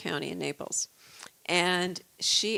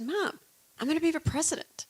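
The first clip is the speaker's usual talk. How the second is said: put-on higher, faster, eager little boy; proud kid announcing his dream, a bit higher; imitating her son; 9 semitones higher than usual. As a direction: mom retelling her eight-year-old's declaration in a lightly raised childlike voice. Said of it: "that's exactly how he said it".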